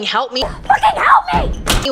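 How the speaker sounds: building to a high, loud desperate scream; yelling for help. Retold or imitated. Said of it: "she's yelling".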